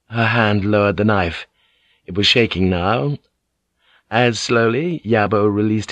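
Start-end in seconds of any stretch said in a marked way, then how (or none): none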